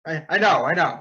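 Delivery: loud, hurried i know; flustered insistence. Acted. Fan told it's audio only, loudly flustered. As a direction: brush it off, flustered.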